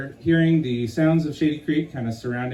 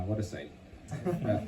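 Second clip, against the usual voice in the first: plain reading voice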